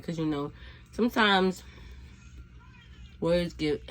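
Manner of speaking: ordinary talk, nothing marked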